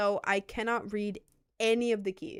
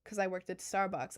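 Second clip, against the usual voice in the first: usual speaking voice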